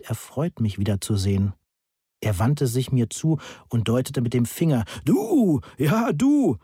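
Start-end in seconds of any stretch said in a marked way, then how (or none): 5.0-6.6 put-on loud, much higher drunken hail; calling out. Drunk Pitt hails Oskar on the street, loud and high. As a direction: bellow at him, pointing.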